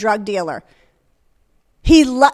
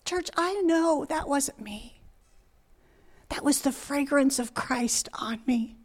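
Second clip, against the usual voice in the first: soft near-whisper to the church; moved, hushed; 11 dB quieter than usual. Real, not acted. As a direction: confide it very softly, sinking into whisper. Moved testimony after tearful story.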